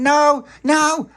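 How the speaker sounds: high, loud scared no; terrified no. Acted. Little Pappy caught from behind, loud frightened no. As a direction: shriek no in fright.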